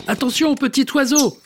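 high urgent warning cry; calling out a warning. Acted. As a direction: shout up to the bird to flee. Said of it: "crie Monsieur Marcelin".

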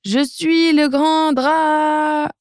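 high, slow, drawn-out attempt at a roar; little dragon's roar. Little dragon tries to roar at the Viking, high and slow. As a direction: try to roar big and fall short. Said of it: "il ouvre grand sa gueule et dit".

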